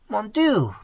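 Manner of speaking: despairing cry. Acted. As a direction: cry out to God. Old man's despairing cry.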